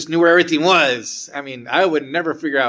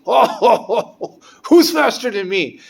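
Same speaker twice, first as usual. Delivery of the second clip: loud, laughing mock outrage, pitched up; laughing indignation; 7 semitones higher, 7 dB louder, much slower than usual. Teacher scoffing at a rival, laughing and loud. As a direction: laugh it out, daring anyone to be faster.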